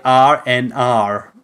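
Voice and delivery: "R and R" slowly with American r's; slow demo of an American accent. Shown as a demonstration. Teaching co-host the trick, "R and R" in American accent. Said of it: "in American accent".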